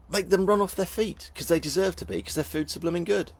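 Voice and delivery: high-pitched comic urging; mock-impassioned plug. Host urges viewers to stock up on food, high mock-impassioned rant. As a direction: squeal out a hammed-up sales plea.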